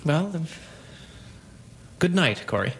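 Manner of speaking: low, subdued farewell with pauses; quiet resignation. Acted. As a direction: withdraw softly, defeated. Husband gives up and leaves.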